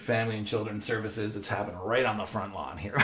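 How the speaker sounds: ordinary delivery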